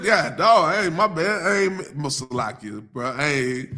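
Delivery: ordinary talk, nothing marked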